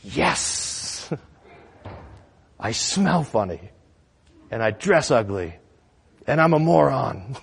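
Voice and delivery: husband conceding. Acted. Husband answering his wife's jab, resigned admission. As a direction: own up item by item, dragging it out. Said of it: "And the husband says".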